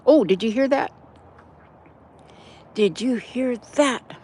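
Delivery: oh, did you hear that; surprised. Real, not acted. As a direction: perk up at a noise. Hearing a sudden splash.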